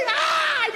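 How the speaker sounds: high mock anguished ay; high wail. Mother wails over her lost son, high-pitched. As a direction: cry out like a distraught mother.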